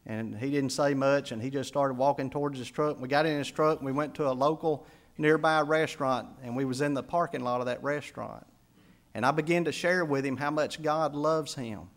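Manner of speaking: ordinary delivery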